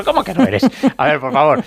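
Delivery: high-pitched retold comeback; mock incredulity. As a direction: imitate a referee disputing the denial. Mimics referee's incredulous retort.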